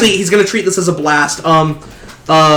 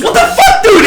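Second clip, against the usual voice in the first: much higher, jumped-up outraged yelp; shocked yelp; 4 dB louder than usual. Acted. Ally yells at hero for falling, shocked high yelp. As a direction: squeak it out in disbelief.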